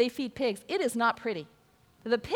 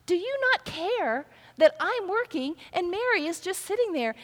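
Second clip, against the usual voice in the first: higher, pleading mock complaint; frustrated complaint; 7 semitones higher than usual. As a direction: whine it at Jesus like you're fed up. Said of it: "she's a little frustrated".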